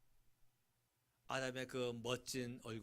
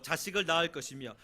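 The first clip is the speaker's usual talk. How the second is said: scripture reading; 5 semitones higher, 14 dB louder than usual. Acted. Loud scripture reading of the phrase.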